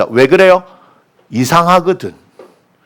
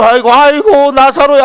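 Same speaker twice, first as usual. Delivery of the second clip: hammed-up high lament; mock mourning; 8 semitones higher, 4 dB louder than usual. Preacher imagines Jesus mourning Lazarus, mock wailing. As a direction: wail the name in pretend grief. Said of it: "한번 좀 울어주고".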